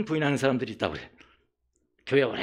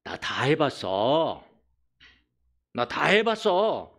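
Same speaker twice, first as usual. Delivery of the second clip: put-on dismissive old churchgoer; 5 semitones lower, slower than usual. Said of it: "종교적 죄인이 와서".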